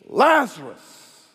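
loud, slow, high call of the name; commanding call. Acted. Jesus calls Lazarus out of the tomb, loud and commanding. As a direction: summon him from the grave. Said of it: "he speaks and says".

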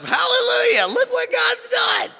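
high, loud ecstatic shout; a squealing, high, slow hallelujah; joyful Elijah. Retold or imitated. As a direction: shout praise to the sky in a high voice. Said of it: "Elijah's like".

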